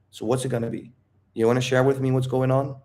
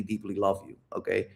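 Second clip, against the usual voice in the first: tender; 5 semitones lower, 9 dB quieter than usual. Shown as a demonstration. Tender role-play reassurance to girlfriend.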